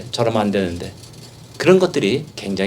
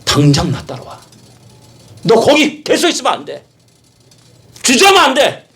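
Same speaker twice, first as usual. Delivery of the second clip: rising to very high and loud; firm command; 13 semitones higher, 4 dB louder than usual. Acted. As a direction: demand he follow without hesitation. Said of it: "너무나 단호하게". (점점 높고 크게, 단호한 명령)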